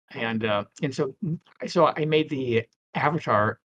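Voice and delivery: ordinary talk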